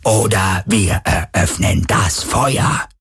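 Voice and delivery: very slow, menacing electronic shout; booming Druhun voice. A narrator's reading. Druhun threaten to open fire, booming machine voice. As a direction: boom the threat out word by word. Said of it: "dröhnte die Druhun-Stimme erneut".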